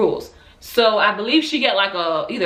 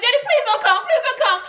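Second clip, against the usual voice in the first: pitched way up, laughing plea; high begging. Retold or imitated. Daughter begging father on the phone, high pitched. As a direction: beg him, high and desperate. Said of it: "I was like".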